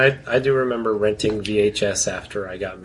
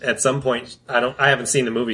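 ordinary talk, nothing marked

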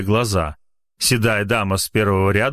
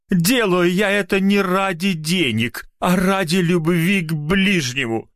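trembling voice; 9 semitones higher than usual. Acted. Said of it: "задрожал голос".

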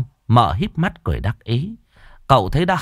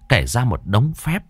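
ordinary delivery, nothing marked

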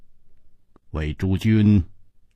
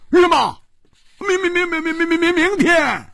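much higher, stammering out 明天 in alarm; blurting in shock. Acted. Hu Tiehua hearing the wedding is tomorrow, shocked stammer. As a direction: jump up and stutter in disbelief. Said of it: "跳起来失声说".

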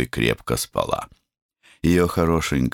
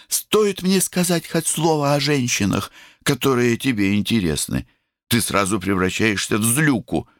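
высокий спорящий голосок; спорь высоко, по-девчачьи; капризная девочка; 10 semitones higher than usual. A narrator's reading. Сестра спорит с братом. (капризный девичий голос)

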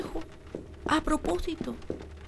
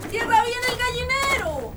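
calling out; 11 semitones higher than usual. Acted. Wife calling to her husband outside, high urgent shout. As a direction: shout urgently toward the yard.

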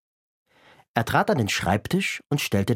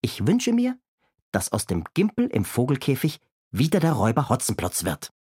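cheeky Kasperl. Acted. Kasperl voiced in reading.

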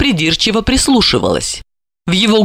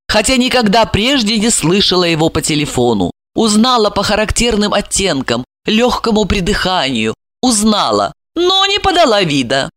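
ordinary delivery

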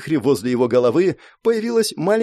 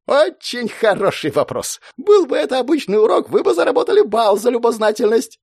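higher, brisk teacher voice; lightly voiced cheerful teacher; 9 semitones higher than usual.

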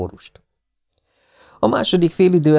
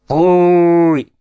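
high, loud echoing boo; the Scottish crowd echoing the old man, booing; 8 semitones higher, 4 dB louder than usual. A narrator's reading. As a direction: boo back like a whole stand of fans. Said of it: "visszhangozta a tábor".

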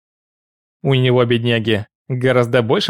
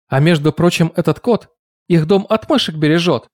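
character voice; 5 semitones higher than usual. Acted. Cat voiced in song.

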